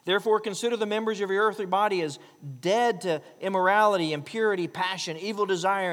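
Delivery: loud, fast fiery scripture reading; preaching at full force. Acted. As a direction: thunder the warning to the congregation. Reads Colossians at full force.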